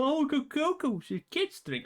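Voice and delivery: high, whiny mock voice of a critic; mocking imitation of a hater. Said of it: "Do you think I'm gonna fucking feel bad for".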